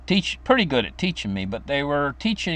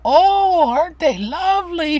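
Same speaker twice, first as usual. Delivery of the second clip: put-on high, delighted old lady voice; sweet old grandma; 7 dB louder than usual. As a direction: coo over the flowers like a fond grandmother. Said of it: "she'd say".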